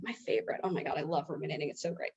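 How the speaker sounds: deadpan sarcasm about her own rumination; sarcastic joke. Pretend. Sarcastically praising her own rumination to listeners, softly and dryly. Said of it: "Just kidding".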